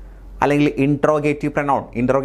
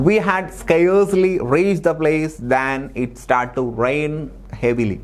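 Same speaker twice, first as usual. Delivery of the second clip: slow dictation read; much slower than usual. Shown as a demonstration. Reading out exam sentence.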